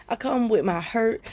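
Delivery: ordinary talk, nothing marked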